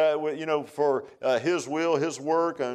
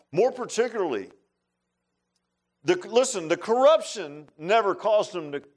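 ordinary talk

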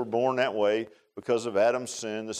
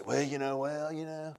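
soft, noncommittal hedging; mock hesitation; 8 dB quieter than usual. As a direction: imitate someone trailing off evasively. Imitates people hedging about being saved.

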